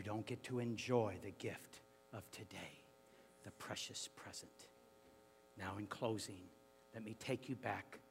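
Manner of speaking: leading the congregation quietly to Calvary; hushed closing. Real, not acted. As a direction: draw them in with a hush. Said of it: "drops to hushed closing".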